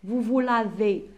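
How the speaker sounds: slow, drawn-out dictation to pupils; slow, deliberate teacher dictation. Shown as a demonstration. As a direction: spell it out slowly for the class. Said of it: "dictating conjugation slowly".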